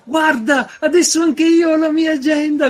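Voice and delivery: high, loud teasing mock voice; mocking shout. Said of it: "mi prendeva in giro".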